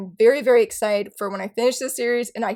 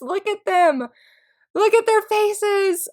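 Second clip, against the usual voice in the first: gushing; 9 semitones higher, slower than usual. Real, not acted. Gushing over manga cover.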